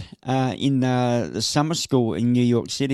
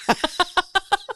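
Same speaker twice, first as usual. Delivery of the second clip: a sudden high giggle at her own attempt; high burst of laughter. Real, not acted. Fails at the accent.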